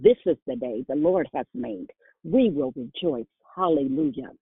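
ordinary talk, nothing marked

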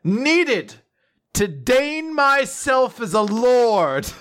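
high, slow, booming proclamation; booming arrogant alien overlord. Pretend. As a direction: bellow it like a self-crowned tyrant. Proclaims himself lord of Scotland.

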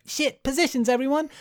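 high hurried command; urgent toys. Retold or imitated. Host voices the toys scrambling into place, high. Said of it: "they're like".

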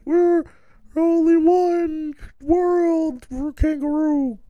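very high, slow, strange squeaky creature voice; young kangaroo's speech. Pretend. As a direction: stretch it out like a tiny animal speaking. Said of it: "And she's like".

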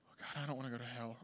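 low, quick, soft mock plea; hushed anxious prayer. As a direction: mutter it like a worried kid praying. Young self praying anxiously in pew.

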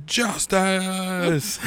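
high gleeful shout of justice; triumphant cheer. Retold or imitated. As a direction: cheer like you've won. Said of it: "we're like".

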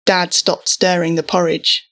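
usual speaking voice

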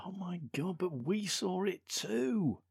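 high, drawn-out wail of disbelief; mock astonished character. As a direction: play it high and stretch the last words. Mock outcry over film scene.

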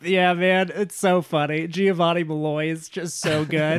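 high, giggly delight; can't stop laughing. Real, not acted. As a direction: talk through real laughter, tickled by it. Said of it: "I can't stop laughing".

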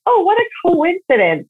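high, light brush-off; mock dismissive. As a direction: wave it off with a lilt.

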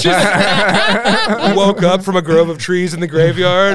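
slow loud laughing telling. Real, not acted. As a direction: laugh your way through it slowly. Laughing through the story.

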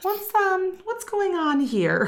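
high, slow, uneasy; growing unease, voice rising high; nervous bride. Retold or imitated.

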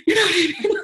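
laughing through tears, high; voice breaking. Real, not acted. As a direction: let it crack between laugh and cry. Breaking after angry outburst.

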